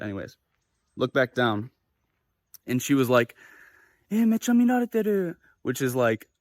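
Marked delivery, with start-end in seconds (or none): 4.1-5.3 high imitation of girlfriend; imitated girlfriend. Girlfriend telling him she's being looked at, high-pitched imitation. Voicing worried girlfriend in story.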